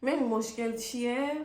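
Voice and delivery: usual speaking voice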